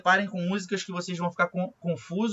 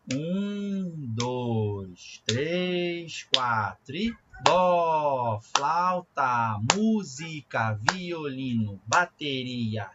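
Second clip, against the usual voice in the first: slow count-in, then lower syllable chant on the beat; very slow, in rhythm; 4 semitones lower, much slower than usual. Shown as a demonstration. Music teacher leading students along, slow rhythmic syllable chant. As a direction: count four slowly, then fit each word evenly into one pulse. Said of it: "Eu vou fazer bem devagar, eu vou contar um".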